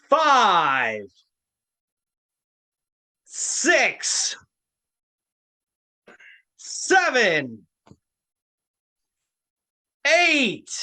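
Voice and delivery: slow, shouted referee count; loud ring count. Acted. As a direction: call each number out loud.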